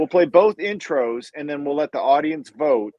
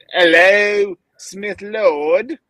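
louder, higher, hammed-up English accent; bad English accent; 3 semitones higher, 5 dB louder, much slower than usual. Host repeats the greeting after teasing, bad English accent. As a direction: hail them in a horrible put-on English accent. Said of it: "Is that your English accent?".

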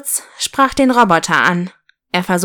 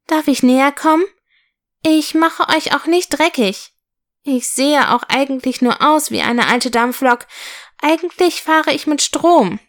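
higher, careful small voice; gentle, high-pitched toy train; timid little locomotive; 6 semitones higher than usual. Acted. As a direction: ask shyly, hoping to be accepted. Little locomotive timidly approaches toys.

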